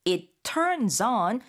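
slow, each word of "it turns on" set apart; crisply word by word. Shown as a demonstration. Teacher showing how to make emphasis come alive, word by word. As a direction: spell out "it, turns, on" deliberately. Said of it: "조금 따박따박 얘기를 하셔야".

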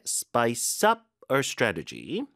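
"spice up" with UP punched; stress on UP. Shown as a demonstration. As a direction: lean on the second word of "spice up". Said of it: "강세 뒤에".

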